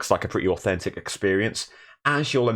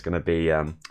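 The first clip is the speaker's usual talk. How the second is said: ordinary delivery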